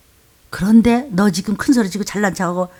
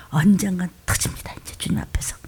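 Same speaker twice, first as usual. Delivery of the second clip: hushed, voiceless warning; whisper; 5 semitones lower, 10 dB quieter than usual. Real, not acted. Preacher warns the congregation in a hushed whisper. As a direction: lean in and breathe the words.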